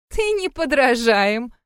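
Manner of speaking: high, drawn-out amused exclamation; mock laughing exclamation. A narrator's reading. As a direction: stretch it out as if highly amused. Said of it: "расхохоталась она". (будто хохоча, высоко и протяжно)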